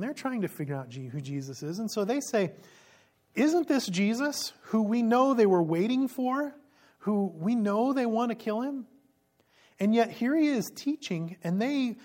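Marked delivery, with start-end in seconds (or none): 3.3-8.9 raised, slow, wondering; puzzled crowd voice. Acted. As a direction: ask it like a puzzled townsman.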